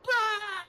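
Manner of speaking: high, loud mock scream; mock panicked shriek; imitated yell. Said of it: "yelling around".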